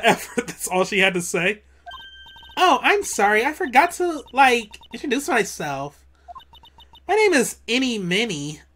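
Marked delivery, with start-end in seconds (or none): 2.6-8.6 put-on high, lilting Valley Girl; ditzy Valley Girl chatter. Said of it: "I gotta do a Valley Girl voice".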